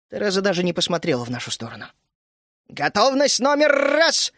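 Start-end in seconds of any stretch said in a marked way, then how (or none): 2.7-4.3 high, drawn-out military call; cried-out command. A narrator's reading. Тереза ordering the gnomes to ready cannons, cried-out command. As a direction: sing out the order, stretching the last word. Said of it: "вскрикнула она по гномье".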